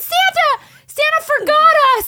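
put-on high, loud child begging the depressed dad for answers; whiny little kid. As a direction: whine like a heartbroken kid to dad.

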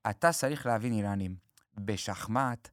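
low, soft, slow Persian-tinged sage; Yosian's slow wisdom. Retold or imitated. Lightly imitating Yosian's slow fable to a rival. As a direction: deliver it slowly like a lecturing sage. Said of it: "כמו שיוסיאן עונה לכולם כזה".

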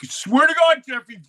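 high, loud pleading at Jeffrey; exasperated call. Retold or imitated. Kid swearing at Jeffrey in a high loud voice. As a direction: swear it up at him, pitched high. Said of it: "I'm like".

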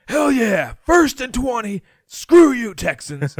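imitating a loud, high-pitched celebrating fan; mock triumphant barroom yell; gloating fan shout. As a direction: whoop it, then jeer at the losing team. Acting out gloating bettor in bar.

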